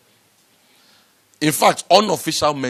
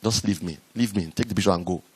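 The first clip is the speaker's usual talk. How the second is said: irritated; 4 semitones lower, 4 dB quieter than usual. Retold or imitated. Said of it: "the person that is snapping is irritated".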